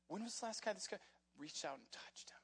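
soft, voiceless wondering whisper; hushed whisper. Real, not acted. Preacher wondering about the untouched leper, in a whisper. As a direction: drop right down and whisper the question.

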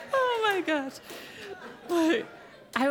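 high, loud, laughing exclamation. Real, not acted. Preacher recovering from laughter, laughing exclamation. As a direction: gasp it out while still laughing.

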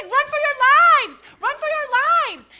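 slow, high, full-throated yell; desperate, drawn-out scream; shouting warning. Retold or imitated. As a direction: roar it at the fleeing crowd. Dream, shouting at swimmers.

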